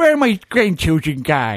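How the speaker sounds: put-on slurred, loud old theatrical voice; Richard Attenborough. Jurassic Park owner looking for grandchildren, hammed-up slurred voice. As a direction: ham up a slushy-mouthed grandfather. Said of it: "that's how he should have spoken in Jurassic Park".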